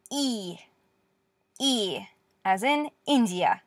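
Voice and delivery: "E" said as a soft ee, as in "India", slowly; slow quiet teacher demo of "India". Shown as a demonstration. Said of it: "this is the soft version".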